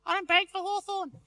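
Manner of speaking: put-on high kid's voice with a lisp; little kid. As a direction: voice a small boy, way up high. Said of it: "Little kid says".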